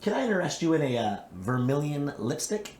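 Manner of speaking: high, eager pushy seller; salesman voice. Pretend. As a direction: pitch lipstick like a door-to-door seller. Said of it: "just sell Mary Kay?".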